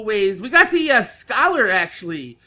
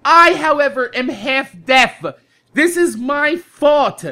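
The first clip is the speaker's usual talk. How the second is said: deliberate, word-by-word retold plea; slow, emphatic explaining; 3 dB louder, slower than usual. Half-deaf customer explaining to a clerk, slow and emphatic. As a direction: spell it out very slowly.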